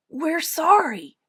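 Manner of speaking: high, slow little bear apology; sheepish admission. A narrator's reading. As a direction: admit it like a child. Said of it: "admitted Little Bear".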